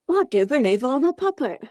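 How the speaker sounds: put-on a puppet trying on a British accent; high puppet voice in a halfway Cockney accent. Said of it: "halfway British, halfway Cockney thing".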